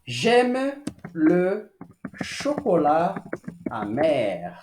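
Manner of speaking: "J'aime le chocolat amer" spoken slowly in French; careful French; slow French model. Shown as a demonstration. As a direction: model the sentence clearly.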